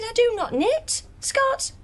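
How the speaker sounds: high-pitched, affronted correction; indignant retort. Acted. Actress rebuffing the wardrobe man's knitting jibe, indignant. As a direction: put him in his place, pitched right up.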